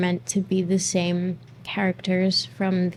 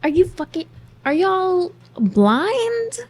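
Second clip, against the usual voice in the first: much higher, slow, louder outburst; exasperated yell; 10 semitones higher, 5 dB louder, slower than usual. Real, not acted. Ranting at viewers who can't see it, slow exasperated yell. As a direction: drag it out in disbelief, half shouting.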